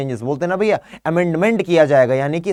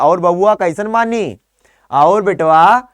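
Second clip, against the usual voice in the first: put-on louder, slower Bhojpuri village accent; Bhojpuri dialect; 2 semitones higher, 6 dB louder, much slower than usual. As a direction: call out warmly in Bhojpuri, rising to loud. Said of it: "इस तरीके से कई सारी बोलियां हैं". (भोजपुरी लहजे में ऊँची पुकार)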